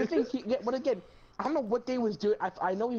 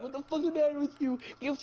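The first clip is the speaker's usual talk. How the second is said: mock scream; 7 semitones higher than usual. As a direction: mimic screaming at the cousin, high. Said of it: "screaming at Luke".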